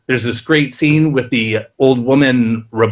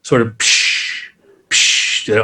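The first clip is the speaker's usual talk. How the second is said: voiced sci-fi pod hiss effect; hissing pods opening; 3 semitones lower, much slower than usual. Shown as a demonstration. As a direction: imitate the air release of a stasis pod, slow. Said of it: "Aliens style".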